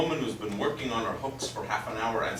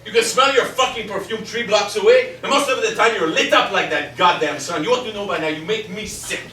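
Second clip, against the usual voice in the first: loud, higher spiteful jabs; spiteful shouted insults; nasty quarrel; 5 semitones higher, 8 dB louder than usual. Acted. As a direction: tear into her with disgust.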